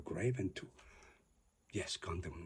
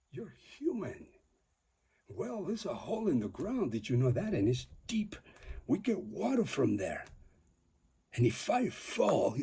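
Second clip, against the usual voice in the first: high raised retort; 7 semitones higher, 9 dB louder than usual. Retold or imitated. Retelling his sharp answer.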